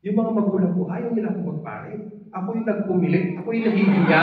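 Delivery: rising emotional emphasis; impassioned. Real, not acted. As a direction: press the shame out with force. Emotional telling of shame.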